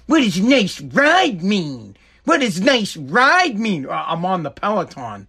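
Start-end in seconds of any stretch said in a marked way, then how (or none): 0.1-2.0 slow, drawn-out suspicious question; mock suspicious wife. Mocking wife grilling him. 2.3-3.9 repeated higher, pressing harder; mock accusing wife. Mocking wife grilling him.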